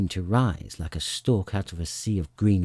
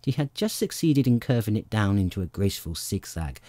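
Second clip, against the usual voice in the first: ordinary delivery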